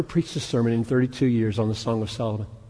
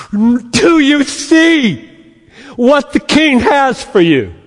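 thrilled; 12 semitones higher, 9 dB louder, slower than usual. Retold or imitated. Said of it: "a preacher ... so thrilled with it".

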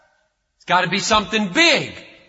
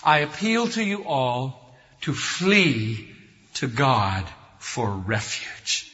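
soft, slow invitation; gentle appeal; 8 semitones lower, 5 dB quieter, slower than usual. Acted. Preacher reading the closing appeal to the church, soft and slow. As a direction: invite them tenderly. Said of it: "I close with an appeal".

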